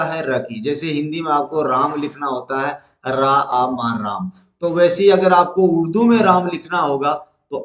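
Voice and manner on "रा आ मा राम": slow, spelling रा आ मा then राम; spelling out राम slowly for students; syllable by syllable. Shown as a demonstration. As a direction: break the word into letters slowly. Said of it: "spelling out राम".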